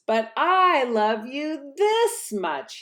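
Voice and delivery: slow, loving big-hare voice; a parent hare teasing gently. Acted.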